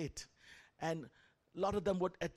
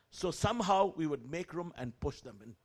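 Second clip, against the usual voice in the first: ordinary delivery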